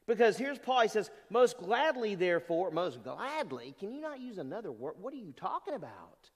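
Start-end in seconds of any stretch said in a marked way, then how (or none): 2.7-6.3 stretched, questioning echo, then softer aside; baffled, mock disbelief at the wording; incredulous.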